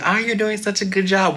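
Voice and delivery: high, loud gushing mock compliment; mock praise. Teacher mimicking empty praise for a student, mock gushing. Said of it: "not the person that's gonna tell you".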